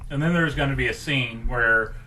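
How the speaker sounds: normal talking voice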